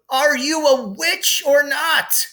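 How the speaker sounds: very high, loud, slow bellow; mock bellow. A narrator's reading. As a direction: yell it in exasperation. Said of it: "Ron bellowed".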